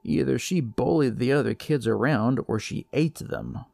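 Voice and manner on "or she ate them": usual speaking voice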